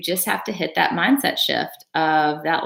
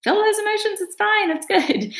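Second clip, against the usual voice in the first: much higher, rising urgent encouragement; urging him on; 11 semitones higher than usual. Retold or imitated. Retelling urging her teary husband to cry, much higher and eager. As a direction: push him to let it all out, voice climbing high. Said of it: "And I was like".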